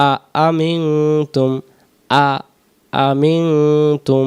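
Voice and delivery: আ আমিনতুম with both hamzas slowly separated; two hamzas. Shown as a demonstration. Teacher shows the two hamzas, slow and separated. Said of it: "দুই হামজায়".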